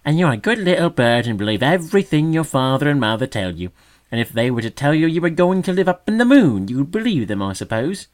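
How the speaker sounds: loud, high scornful sneer. A narrator's reading. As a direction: sneer at the naive little bird. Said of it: "sneered the magpie scornfully".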